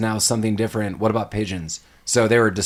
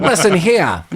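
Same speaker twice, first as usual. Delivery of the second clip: higher cartoon wiseguy voice; mock gangster; 6 semitones higher, 4 dB louder than usual. Cartoon pigeon plotting crimes, higher mock-gangster voice. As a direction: play a scheming cartoon tough.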